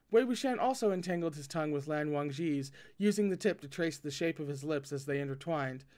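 ordinary delivery, nothing marked

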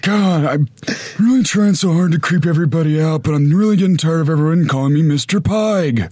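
high, loud, exasperated creep voice; weary villain. Acted. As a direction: whine about being called Mister Pig. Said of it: "talk a little more like this".

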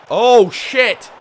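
high, loud shocked curse; shocked outburst. Real, not acted. Manager seeing his defence beaten, shocked shout. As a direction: blurt it out as the danger hits. Conceding a chance.

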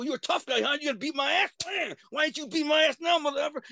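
high, loud mocking yell while beating him; reliving a furious street beating, shouting; angry taunting shout.

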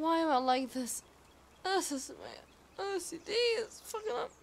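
ordinary talk

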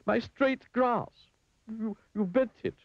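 very high, drawn-out cry of dismay; acted high-pitched outrage. A narrator's reading. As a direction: wail it slowly, aghast and accusing. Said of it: "cried Mister Fussy".

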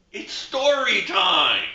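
slow, loud announcement; announcing story time. Acted. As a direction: call it out slowly and loudly.

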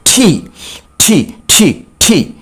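ঠি said crisply, a hard retroflex ঠ; ঠি repeated as a clear drill sound. Shown as a demonstration. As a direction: model the ঠি slowly, one at a time. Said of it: "উচ্চারণটা খুব মনোযোগ সহকারে লক্ষ্য করার ব্যাপার".

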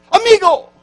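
"Amigo." called out high and loud, Spanish style; mock Spanish. Shown as a demonstration. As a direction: proudly show off "Amigo.". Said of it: "How do you say friend?".

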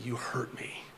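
hushed, breathy whisper. Retold or imitated. Mother confiding her hurt to her child, whispered. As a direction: breathe it out, barely voiced.

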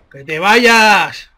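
loud, very drawn-out command; exasperated shout. Real, not acted. Gamer ordering an opponent who will not go down, exasperated shout. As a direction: stretch "vayas" into a long yell.

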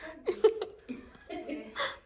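high-pitched burst of laughter; laughing. Real, not acted. Chatting about what to say, high laughing.

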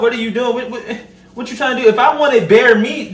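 ordinary talk

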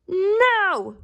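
high, drawn-out shouted refusal; high storybook pig yell; shouting. A narrator's reading. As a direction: shout it like all the little pigs. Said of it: "shouted all the pigs together".

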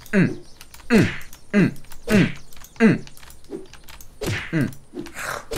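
short effort grunts; punching grunts. Pretend. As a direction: grunt with each thrown punch. Throwing punches in the game.

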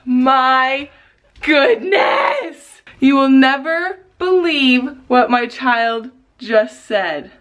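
high, drawn-out delighted exclamations; amazed excitement. Real, not acted. Mother amazed her child just spoke, excited. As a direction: gush in real amazement.

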